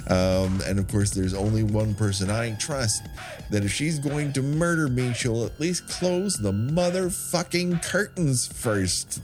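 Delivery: usual speaking voice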